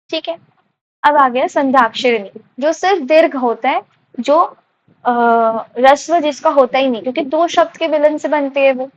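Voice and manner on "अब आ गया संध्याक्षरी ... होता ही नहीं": ordinary delivery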